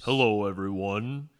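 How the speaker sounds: slow, loud mock-deep greeting; hammed-up low morning voice; playful low voice. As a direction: stretch the greeting out in a deep radio voice. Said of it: "we can have a low, low voice".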